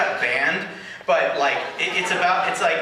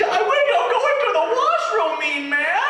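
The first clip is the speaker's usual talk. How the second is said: mock squeak of the woman heading to the washroom; squeaky mimicry. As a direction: squeak it like the flustered audience member. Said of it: "did you see how she squeaked".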